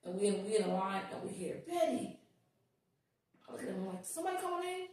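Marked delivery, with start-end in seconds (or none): 1.7-2.2 high, lightly voiced name call; high-pitched call, falling at the end; retold calling out. Retold stranger calling out her name. 4.0-4.9 puzzled retold question. Retold puzzled reply to the call.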